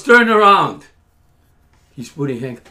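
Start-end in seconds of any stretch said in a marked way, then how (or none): 0.0-0.9 louder, higher police shout; retold insistent order. Officer repeating his order to the man, forceful shout.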